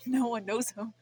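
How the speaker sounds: laughing through sadness; sad laugh. Real, not acted. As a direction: laugh it off sadly.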